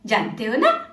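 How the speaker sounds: ordinary talk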